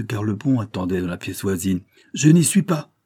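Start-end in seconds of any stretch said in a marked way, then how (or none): 2.1-2.9 high, loud refusal; loud call, not receiving anyone; crying out. A narrator's reading. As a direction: shout it toward the door. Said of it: "cria madame Dalbreuse".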